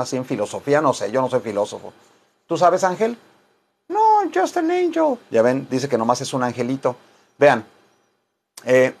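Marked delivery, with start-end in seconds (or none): 3.9-5.2 high little angel voice; tiny high-pitched angel reply. Acted. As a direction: squeak it sweetly like a small angel.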